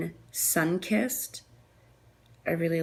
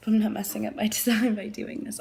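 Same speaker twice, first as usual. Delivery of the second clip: whispered aside; 3 semitones higher than usual. Real, not acted. Whispering to herself about not ruining the design. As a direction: mutter it to yourself with a chuckle.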